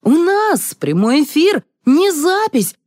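high-pitched, alarmed outburst; agitated protest. A narrator's reading. Man in jeans reminding colleagues it is live, agitated. Panicked crew before live broadcast.

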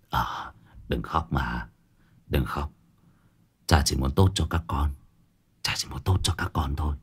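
very low, hushed father voice; gentle consoling. A narrator's reading. Father comforts the children after a beating, low and hushed. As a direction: soothe a crying child, sinking almost to a whisper. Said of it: "nhẹ nhàng an ủi".

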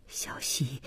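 very soft, low murmur; low, barely voiced appeal; hushed plea. A narrator's reading. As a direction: call the name under your breath. Husband pleading as wife leaves.

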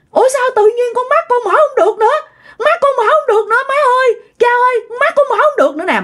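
very high, distressed acted wail; panicked crying out. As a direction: wail in panic to your parents. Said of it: "Mà ba la um sùm luôn". (la hoảng, giọng cao vút)

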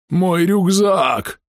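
high drawn-out wail over the backpack. A narrator's reading. Half-orc wails over his pack.

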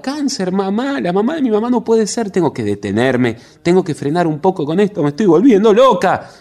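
panicked outburst, trying to stop herself; frantic cry. Acted. Margarita losing control over her fears, frantic cry. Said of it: "gritaba Margarita".

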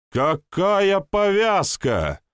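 slow, high, loud hysterical scream; hysterical yelling. A narrator's reading. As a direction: shriek it in outrage. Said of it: "истерично заорал лейтенант".

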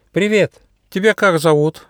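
high, lively little mouse voice; little mouse Gosha. Acted. Mouse greets a forest mouse he just met, high and friendly.

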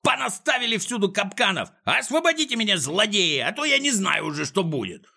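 high, frantic villain wailing; shrieked threats and pleas; pained angry squealing. A narrator's reading. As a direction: screech at careless servants while in pain. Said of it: "завизжал он, корчась от боли".

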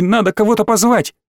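put-on high, urgent child protest; balky insistence. A narrator's reading. Small boy insists on getting help, balky. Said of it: "заортачился мелкий".

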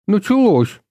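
loud high cry, it's begun; boy shouting as fight begins; excited shout. A narrator's reading. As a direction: burst out loud.

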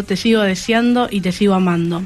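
normal talking voice

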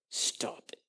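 very soft, unvoiced warning; exasperated whisper. Fed-up God to whining people, hushed exasperated whisper. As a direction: cut them off under the breath.

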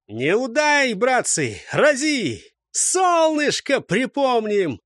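high, urgent shouts, last one drawn out; rallying battle cry. A narrator's reading. Fighter urges brothers on in the melee, rallying cry. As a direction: urge your brothers to strike.

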